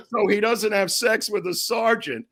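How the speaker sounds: high-pitched, laughing through the words; real amusement breaking into the voice. Real, not acted. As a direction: tell it while cracking up. Teller cracks up at own story.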